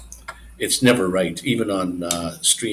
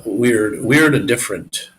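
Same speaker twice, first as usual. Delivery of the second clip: loud, punched weird; emphatic; 3 semitones higher, 9 dB louder than usual. Real, not acted. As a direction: push out weird louder. Stressing how odd winter is.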